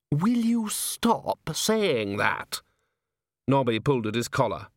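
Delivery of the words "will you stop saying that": high, slow, drawn-out demand; exasperated. Acted. A chair is sick of Nobby's refrain, exasperated. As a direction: stretch the words in frustration.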